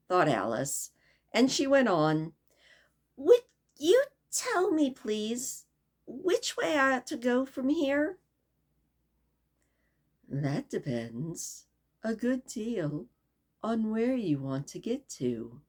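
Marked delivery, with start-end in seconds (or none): 3.2-8.2 put-on light, high girlish voice, politely asking; a young girl asking the way, eager; lightly voiced curious girl; Alice's voice. 10.3-15.6 slow, soft, knowing cat; lightly voiced lazy cat; Cheshire Cat voice.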